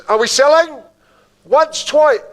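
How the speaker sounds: high, loud auction call; rally the room toward the sale; calling out bids. Real, not acted.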